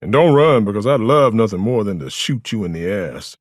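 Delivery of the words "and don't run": higher, louder order; stern warning. A narrator's reading. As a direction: warn a prisoner firmly. Officer warns the prisoner.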